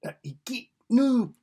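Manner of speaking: put-on false start at a Scottish accent, breaking off high; rough Scottish accent try-out; attempted Scottish accent. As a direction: have a stab at Scottish, then give up. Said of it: "I could do my best Scottish accent".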